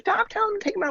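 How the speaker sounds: very high, hammed-up mom's scolding; mock exasperated order in a squeaky pitch; complaining. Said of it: "complain, like".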